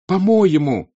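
high, loud, furious repeat; rising into an angry cry; Natasha almost shouting. Acted. Natasha turning angrily on Petya, almost shouting. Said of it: "вдруг закричала почти Наташа".

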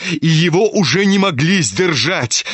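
ordinary delivery

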